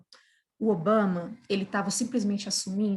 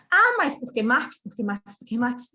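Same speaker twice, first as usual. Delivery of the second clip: mock whiny question; 4 dB louder than usual. Mocking the lady's repeated question.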